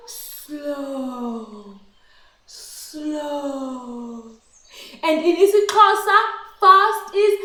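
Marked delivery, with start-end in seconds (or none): 0.1-4.3 low, soft and drawn out; slow. Shown as a demonstration. Teacher showing slow again, soft and drawn out. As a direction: let it sink slowly. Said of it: "and slow".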